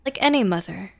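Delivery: plain reading voice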